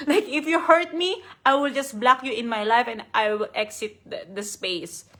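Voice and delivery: ordinary talk, nothing marked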